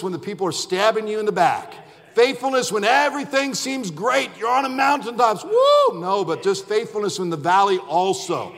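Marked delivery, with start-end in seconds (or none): 5.4-6.0 a sudden high whoop of joy; excited woo. Acted. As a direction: whoop like you're on the mountaintop. Mountaintop excitement shout.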